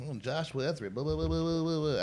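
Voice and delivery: low, soft code-switched professional voice; job interview voice. Acted. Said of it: "I gotta change my voice".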